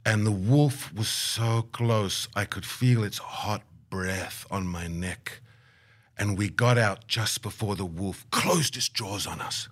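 slow, suspenseful imitation of the elder; grave, drawn-out dramatic account; ominous storytelling. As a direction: stretch the phrases as if still feeling the danger.

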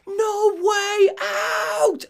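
very high, long-drawn strained scream; muffled scream. Acted. Alan heard screaming as the camera leaves, muffled scream. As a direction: scream it as if from far away, held back. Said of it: "screaming at the top of his lungs".

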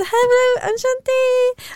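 high-pitched, singsong hello; giddy greeting. Real, not acted. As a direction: greet the fans in high, excited French. Greeting Belgian fans in French.

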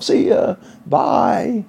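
breathy see ya, then a high squeaky bye; mock cheery farewell. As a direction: wave the press off with a jokey goodbye. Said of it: "mock bodyguard P R officer tone".

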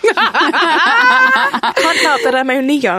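laughing. Real, not acted. Teased, laughing reply.

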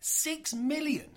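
slow, high, incredulous; shocked. Real, not acted. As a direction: repeat it in disbelief. Shocked at the budget.

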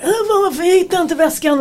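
high-pitched frantic complaint; mock outburst. Mother imitating her own outburst to her family, mock frantic. Mimics outburst minutes after agreeing.

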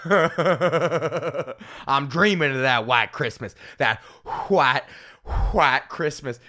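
very slow, drawn-out Southern drawl; Southern accent. Shown as a demonstration. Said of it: "Christmas carols with a southern accent".

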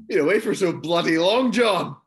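high, loud playful complaint; mock exasperation. Mock complaint about long absence.

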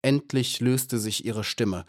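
ordinary delivery, nothing marked

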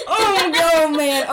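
laughing. Real, not acted. Reacts to friend gagging.

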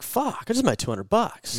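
amazed excitement. Retold or imitated. Maker thrilled by first sale, amazed high excitement. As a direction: squeal out the easy win. Maker's surprise at quick money.

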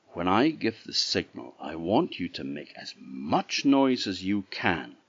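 usual speaking voice

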